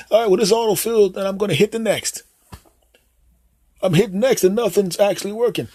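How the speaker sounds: put-on high, hesitant old dad voice; clueless dad. Dad calling his son Jim for phone help, high and unsure. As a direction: play the fumbling father asking for help. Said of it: "You go up to them".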